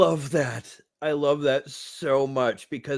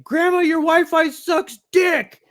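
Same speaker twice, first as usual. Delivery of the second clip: whiny grandson; 12 semitones higher, 6 dB louder than usual. Retold or imitated. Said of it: "he's like".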